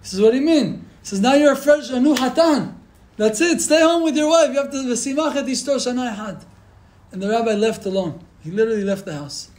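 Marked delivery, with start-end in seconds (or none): 0.2-0.8 higher-pitched, insistent retort; emphatic protest. Retold or imitated. Rabbi refusing an escort home, emphatic. Rabbi rejects husband's offer. 1.2-6.4 high, raised-voice insistence; acted rabbi sending him back with fervor; emphatic urging. Rabbi telling the new groom to stay home, emphatic.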